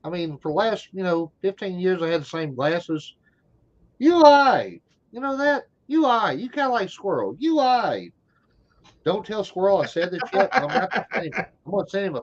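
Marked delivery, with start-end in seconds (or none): 4.0-8.1 high, loud playful accusation; teasing you lie. Real, not acted. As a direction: call him out with a grin.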